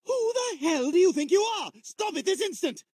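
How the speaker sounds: high-pitched imperious fury; worked-up, high scolding; indignant outrage. Acted. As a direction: demand it in haughty outrage. Indignant at losing his room.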